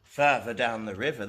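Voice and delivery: ordinary delivery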